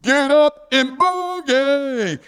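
high, long-drawn holler sliding down at the end; stretched-out, high-pitched catchphrase yell; mock scream. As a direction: holler it like a call from far down the road. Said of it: "he would scream out".

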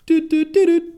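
high, loud sung beeps of a cartoon alert; Kim Possible beeps. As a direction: sing the little alert jingle, doot doot. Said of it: "you hear the little Kim Possible".

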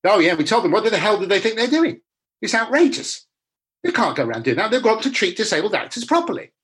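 hammed-up righteous fury on his behalf; mock outrage. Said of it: "my new agent was the one that said all that".

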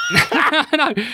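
high, laughing admission; cracking up while conceding. Real, not acted. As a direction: squeeze the words out through laughter. Host cracking up at Frozen splice.

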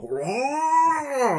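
huge high roar, hammed up; huge fiery dragon roar. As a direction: belt out a big beast roar. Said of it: "they probably don't sound like that".

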